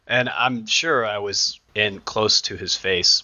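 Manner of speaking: ordinary delivery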